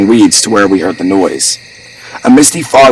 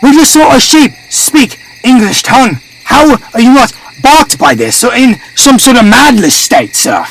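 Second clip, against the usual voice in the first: high-pitched, raised-voice arguing; agitated protest. A narrator's reading. Servant argues they heard a sheep speak, high agitated voice. Heated argument about the talking sheep.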